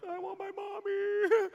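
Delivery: put-on high, slow whimper like a scared kid; hammed-up childish whine; whiny scared child. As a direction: play the terrified little boy. Rocking in corner, scared.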